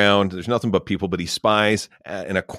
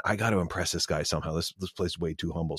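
donkey musing quietly, softer; a soft worried aside to himself; 4 semitones lower, 11 dB quieter than usual. Acted. Donkey alone at home worrying, speaking quietly.

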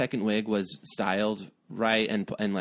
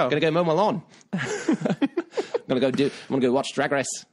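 giggly mock Kiwi accent, pitched up; New Zealand accent; 4 semitones higher than usual. Shown as a demonstration. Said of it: "given them a New Zealand accent".

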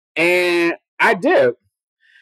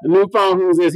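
petty. Retold or imitated. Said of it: "I'm being petty".

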